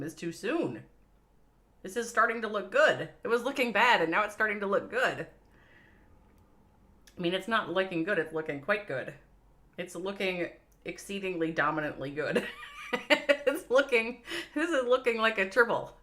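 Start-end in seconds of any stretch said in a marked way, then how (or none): 1.8-5.3 excited. Real, not acted. Caster reacts to attack turning. 12.5-13.4 burst of laughter. Real, not acted. Laughs at the triple. 13.5-16.0 laughing. Real, not acted. Laughing at the triple.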